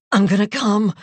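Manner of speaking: loud, high gasping cry; gasped out. Acted. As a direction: push the words out on a gasp. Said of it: "he gasped out of courtesy".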